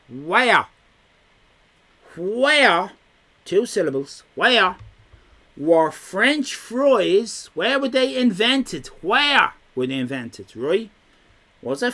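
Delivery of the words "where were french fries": slow, drawn-out quiz question. Shown as a demonstration. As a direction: stretch it out for suspense. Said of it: "quizmaster drawing out question".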